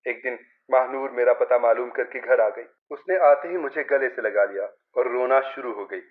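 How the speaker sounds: plain reading voice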